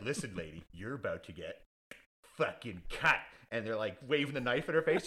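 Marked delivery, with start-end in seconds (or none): 0.0-3.2 much lower, softer thug growl; low menace, leaning in; mock menacing thug. Yakuza thugs threaten the lady, low and soft.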